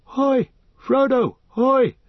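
loud high hail from a friend; loud hoy calls; distant cry. Acted. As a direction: call out to be found. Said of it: "he heard a cry".

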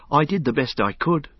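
put-on light young voice, eager to please; anxious young hobbit. A narrator's reading. Pippin voiced in reading.

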